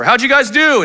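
high, loud voice as dad; dad's check-in. Retold or imitated. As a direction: play the boss father checking work. Dad checking the boys' sanding.

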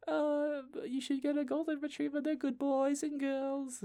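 high, soft, squeaky coaxing; loopy gushing. Acted. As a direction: coax dreamily in a silly high voice. Acts out loopy self recommending dogs.

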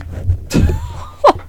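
shrieking burst of laughter. Real, not acted.

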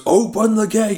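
lightly voiced villain command. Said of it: "when he goes".

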